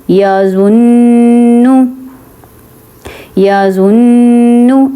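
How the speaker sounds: یا زنو slow, with the ن held long through the nose; nasal ghunna. Shown as a demonstration. Teacher showing ghunna through the nose. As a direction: hum the ن of زنو through the nose, tongue on the palate. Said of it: "غنہ میں کیا ہوتا ہے کہ ناک میں آواز نکالتے ہیں".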